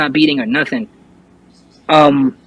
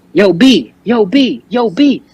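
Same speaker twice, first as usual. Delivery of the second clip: higher-pitched repeated call, imitating a coworker; 7 semitones higher, slower than usual. Coworker calls to a man frozen in the room, retold urgent calling. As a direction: call as if to snap a friend out of it.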